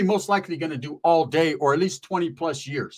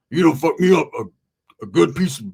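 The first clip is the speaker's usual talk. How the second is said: Roach's voice; 5 dB louder than usual. Retold or imitated. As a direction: play the angry friend on the phone. Roach voiced on phone call.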